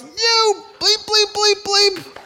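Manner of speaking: high, loud bleeped-out screaming at the driver; retold angry yelling. Young man cursing at a car ahead, high furious yell. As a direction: yell the curses out the car window. Guy yells out car window.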